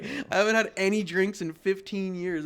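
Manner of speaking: high, exasperated mock outburst; acting out an incredulous comeback; indignant retort. Retold indignant reply to fans.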